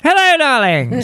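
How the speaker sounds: theatrical high "hello darlings"; campy drag queen. Acted. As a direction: camp it up like a glamorous host. Opening her show segment theatrically.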